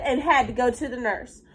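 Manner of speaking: normal talking voice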